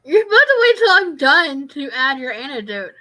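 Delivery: loud telling-off of an interrupting co-host; exasperated, loud playful reprimand; mock scolding. Real, not acted. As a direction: scold your partner for cutting in.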